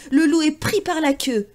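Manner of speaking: usual speaking voice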